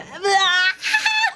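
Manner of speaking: high wailing laugh of disbelief; shocked laugh-cry. Real, not acted. Shocked by killer reveal.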